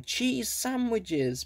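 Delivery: high, slow pleading; begging. A narrator's reading. Mister Whiskers begging for his wish, high slow plea. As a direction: draw it out like a plea. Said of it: "begged Mister Whiskers".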